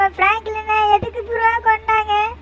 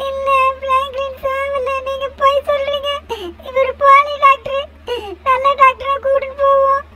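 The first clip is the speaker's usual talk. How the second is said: wailing grief, higher pitched and pleading; crying plea; 3 semitones higher than usual. Acted. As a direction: sob out the name, then beg him to come back. Friend pleads over dead Franklin.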